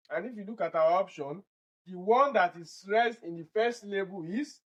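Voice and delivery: ordinary talk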